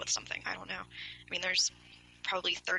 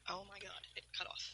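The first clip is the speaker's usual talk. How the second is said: whispered, much softer, alarmed aside under the breath; startled muttering to self; 19 dB quieter than usual. Real, not acted. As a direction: mutter it to yourself as the line drops. Call cuts off, mutters to self.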